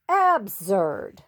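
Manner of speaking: ordinary delivery, nothing marked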